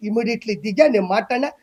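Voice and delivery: usual speaking voice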